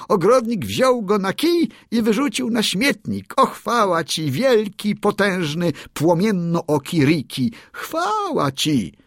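high, eager bird voice singing praise; proud songbird crowing the victory; excited little bird. A narrator's reading. Darci's hymn of praise.

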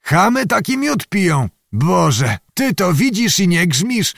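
high-pitched astonished outrage; indignant. A narrator's reading. Zagłoba finds peasants drinking fine mead, indignant. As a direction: appeal to heaven in indignation. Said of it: "zdziwienie, ale i oburzenie".

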